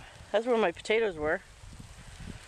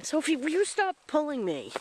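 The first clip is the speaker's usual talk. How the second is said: scolding the dog; 10 semitones higher than usual. Real, not acted. Dog tugging on the leash.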